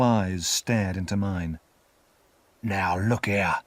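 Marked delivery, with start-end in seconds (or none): 2.6-3.6 slow, rough warning; menacing convict. A narrator's reading. Convict leans over the boy, menacing. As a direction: say it slowly as a threatening convict. Convict begins his orders.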